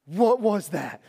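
mildly yelled panicked question; mock startled yell; retold yelling. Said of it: "She's yelling, she's screaming".

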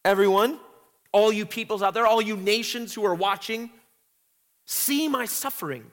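voicing Lady Zion's cry. Teacher voicing Zion calling to the nations, pleading. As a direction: cry out to everyone watching. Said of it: "there's a cry to".

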